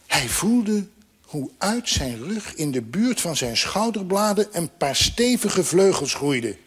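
ordinary delivery

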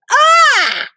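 high, loud terrified scream; shrill startled cry of horror; panicked scream. Acted. Screams at blaster arm.

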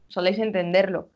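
normal talking voice

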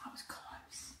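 unvoiced, very soft whisper. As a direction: whisper it under the breath. Whisper after clearing throat.

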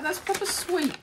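playful high cutesy voice; put-on cutesy voice. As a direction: say proper sweet in a tiny high put-on voice. Echoes phrase in a put-on voice.

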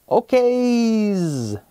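high buzzy call to the hidden flies; fly calling out. Acted. Cousin fly calls out the family, high buzzy call.

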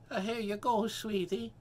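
high, sweet little-lady voice; high-pitched pet-owner cooing; lady cooing to dog. Acted. Lady feeds her dog.

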